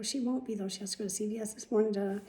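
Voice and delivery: ordinary talk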